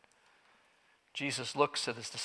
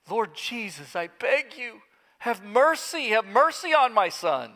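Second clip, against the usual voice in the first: rising, louder cry for mercy; begging father; 7 semitones higher, 4 dB louder than usual. Acted. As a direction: beg with everything left.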